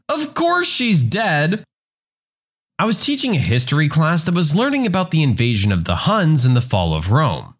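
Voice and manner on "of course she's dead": high, slow student voice; higher-pitched, drawn-out schoolkid retort; know-it-all student. Acted. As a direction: draw it out as an obvious correction. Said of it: "student number two".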